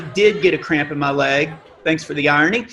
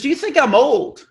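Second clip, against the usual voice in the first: put-on old man voice; 7 semitones higher than usual. Old man repeats question.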